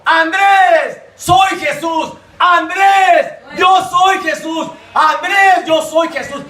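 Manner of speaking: high, stretched call by name; ringing retold summons, repeated insistently; imitated solemn calling. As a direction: call out as Jesus summoning him. Jesus calls the dying man.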